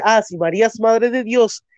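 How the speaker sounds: ordinary delivery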